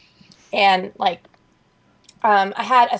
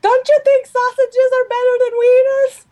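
very high, loud screech; comic shrieked parting question; yelling grandma impression; 5 dB louder than usual. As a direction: holler it like an excitable grandmother. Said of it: "then she yells and she's like".

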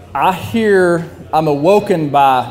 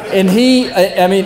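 usual speaking voice

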